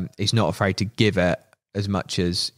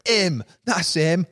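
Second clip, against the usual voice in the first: mock accusation; 8 semitones higher, much slower than usual. Accusing someone, pointing them out.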